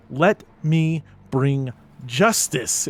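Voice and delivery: slow, loud, weighty promise; solemn vow. Acted. To the ghost, vowing justice, slow and loud. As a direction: draw it out like an oath.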